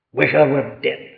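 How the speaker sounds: weary sigh. Retold or imitated. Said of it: "You wish you could go out of it all".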